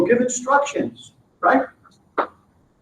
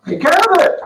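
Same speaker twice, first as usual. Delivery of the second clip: higher, louder "Take care of it" as the solid performer; eager worker's reply; 8 semitones higher, 5 dB louder than usual. Acted. Solid performer answering his boss, eager and ready. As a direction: answer like a willing worker happy to take the job.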